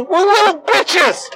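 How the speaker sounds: high, drawn-out mocking cry; whiny mockery. Real, not acted. Ranter scolding us all as whiny, high mocking wail. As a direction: stretch it out in a high, slow whine.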